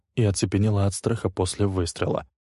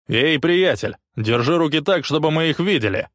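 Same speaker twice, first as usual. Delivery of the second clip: loud, higher, menacing order; thundering command; 8 semitones higher, 5 dB louder than usual. A narrator's reading. A stern officer ordering Rick's hands up, thundering command. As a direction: boom the order from behind. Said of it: "прогремел грозный мужской голос".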